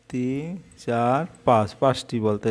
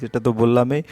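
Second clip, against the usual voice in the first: ordinary talk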